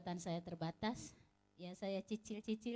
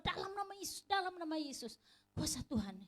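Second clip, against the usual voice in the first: much higher-pitched urgent prayer; retold urgent invocation; 8 semitones higher than usual. Woman retells fighting her seizure in Jesus' name, high urgent invocation. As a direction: re-enact a pleading battle cry, without full volume.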